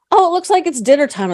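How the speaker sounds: put-on high, bright-pitched old lady voice; imitating mom suddenly cheerful; mom cheerfully distracted. Mother seeing dinner time, high mock cheerful. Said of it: "she looks in the window and goes".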